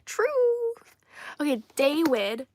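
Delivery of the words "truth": truth in a high, loud falsetto squeal. Shown as a demonstration. Playfully squealing Truth to the chat.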